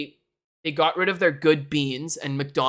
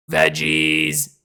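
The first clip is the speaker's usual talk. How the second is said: "Veggies." much lower and louder; silly low voice; 11 semitones lower, 6 dB louder than usual. Shown as a demonstration. Streamer repeats the tier list title in a goofy, much lower voice. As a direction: drop "Veggies." down deep like a goofy character.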